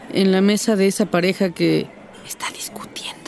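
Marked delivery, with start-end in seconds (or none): 2.3-3.2 discreet whisper. Acted. Woman to husband about the arguing couple nearby, discreet whisper. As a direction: drop to a hushed, unvoiced whisper.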